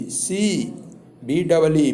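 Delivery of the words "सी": सी as English see with a long ee. Shown as a demonstration. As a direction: model सी after the spelling. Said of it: "S double E".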